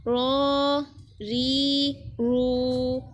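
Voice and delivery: heavy rolled रॉ and रू, light री; full and thin ra. Shown as a demonstration. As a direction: round out रॉ and रू, thin री, all held long. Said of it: "रा को पुर पढ़ेंगे". (रॉ और रू पुर, री बारीक पढ़ें)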